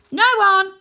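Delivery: high, loud quick denial; boy quickly covering an insult; hasty cover-up. A narrator's reading. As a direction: blurt it to hide the truth. Arthur hastily covers for Rose.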